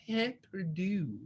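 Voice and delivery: Pain perdu said slowly in a put-on French accent, with a soft breathy P. Shown as a demonstration. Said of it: "great French accent coming through".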